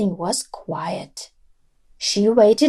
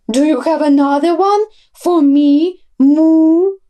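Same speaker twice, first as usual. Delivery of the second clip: mooing plea, louder at the end; a cow begging the cat; pleading cow; 4 semitones higher, 4 dB louder than usual. A narrator's reading.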